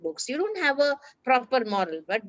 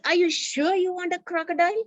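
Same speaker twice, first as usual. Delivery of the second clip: doubtful friend; 8 semitones higher than usual. Acted. Friend asks Arun, doubting.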